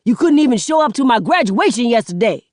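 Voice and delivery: a son unloading on his mother, fast and loud; angry rant. Acted. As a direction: rattle it all off at her, fuming. Son's angry rant at stepmother.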